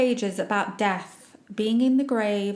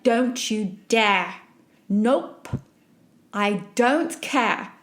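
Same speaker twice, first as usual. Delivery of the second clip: slow, firm, defiant commands to yourself; daring the panic attack to come; angry self-talk; much slower than usual. Retold or imitated. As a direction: shut down the panic, word by word. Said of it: "just out of anger".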